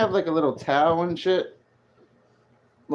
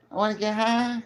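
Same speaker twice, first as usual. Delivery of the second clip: South Park quote; 7 semitones higher, 4 dB quieter than usual. Retold or imitated. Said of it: "Remember that? ... South Park".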